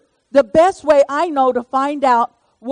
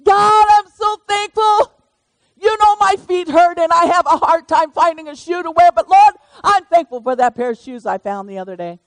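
prayer voice; 5 semitones higher, 4 dB louder than usual. Shown as a demonstration. Demonstrating her thankful prayers.

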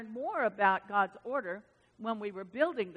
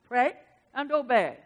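lightly imitated Japanese accent, louder; 8 dB louder than usual. Quoting Doctor Cho's sermon, light mock Japanese accent. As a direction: quote him with a hint of Japanese accent. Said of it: "He would say that in his Japanese accent".

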